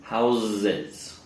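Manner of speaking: HOUSES as "HOWZZ-iz"; slow demonstration of HOUSES with voiced z; vibrating Z sound. Shown as a demonstration. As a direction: hold the buzz in the middle of HOUSES. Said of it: "the vibration".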